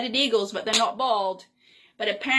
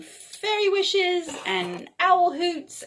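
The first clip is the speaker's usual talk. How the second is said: slow, higher, tender sign-off; 7 semitones higher, slower than usual. Real, not acted. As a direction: linger over each wish. Cosy sign-off to listeners.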